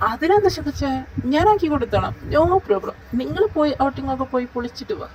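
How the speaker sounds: gushing friend offering to help; eager excitement. Acted. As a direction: jump at the chance to help. Eager friend volunteering happily.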